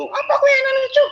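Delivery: high, loud mock snap at a passerby; imitating an angry person on the street; angry stranger. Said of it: "Ketika ada orang marah-marah itu".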